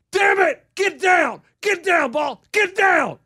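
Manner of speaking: loud, high shouts at the ball; imitated golfer yelling. Mimicking golf partner yelling at his shot. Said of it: "first thing he said".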